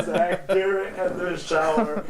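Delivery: laughing through the words; laughing repeat. Retold or imitated.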